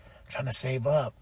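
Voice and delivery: quiet, resigned self-talk; soft reminder to save money; hushed, half-joking restraint; muttered to self. Retold or imitated. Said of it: "and I'm like".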